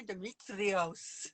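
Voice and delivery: put-on very slow, proud Greek accent. Shows off the Greek accent to her viewers. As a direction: roll the name out in a Greek accent. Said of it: "I got that accent, don't I".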